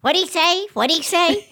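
lightly voiced old lady straining to hear; Myrtle, hard of hearing. Joke teller voicing deaf old Myrtle, high and puzzled. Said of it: "And Myrtle goes".

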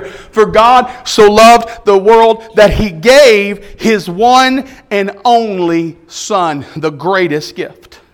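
very slow, weighted recitation of the verse; slow it right down and let each word land; solemn scripture. Acted. Preacher reciting John 3:16 to the congregation, slow and solemn.

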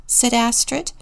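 plain reading voice

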